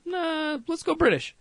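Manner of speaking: high-pitched, put-on dismissive voice; mock dismissive. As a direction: wave it off in a silly high voice. Mocking a film-makers' casting decision.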